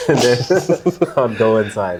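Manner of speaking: laughing. Real, not acted. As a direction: giggle your way out the door. Jokes about ditching booth duty.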